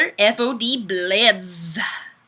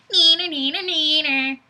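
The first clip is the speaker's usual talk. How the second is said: teasing singsong; 6 semitones higher than usual. Acted. Taunting chat comment read aloud.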